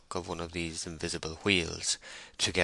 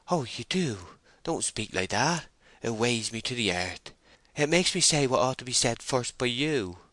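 higher, plaintive female character voice; pleading young woman; 5 semitones higher than usual. Acted. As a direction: plead gently, a little hurt.

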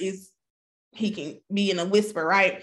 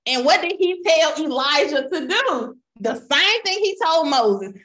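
normal talking voice

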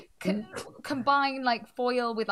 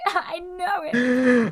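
laughing; 7 semitones higher, 7 dB louder, much slower than usual. Pretend. Mock accusation, laughing.

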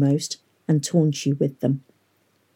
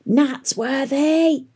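high, drawn-out braying shout; braying call; 10 semitones higher, much slower than usual. A narrator's reading. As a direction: call out across the crowd. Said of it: "called a braying voice".

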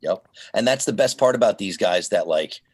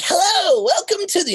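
high, strained mock radio greeting; tightened-up announcer. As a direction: imitate a tense coworker jumping way up in pitch. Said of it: "like, sphincter tightened".

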